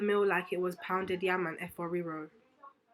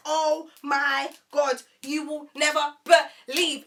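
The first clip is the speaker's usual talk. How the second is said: loud, slow, word by word; typing in caps; 4 semitones higher, 9 dB louder, much slower than usual. Retold or imitated. Texting her friend in caps, loud emphatic disbelief. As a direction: punch every word as if in capital letters. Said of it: "I'm typing in caps. I'm like".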